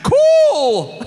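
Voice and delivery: excited shout. Acted. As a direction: blurt it out thrilled. Wizard reacts gleefully.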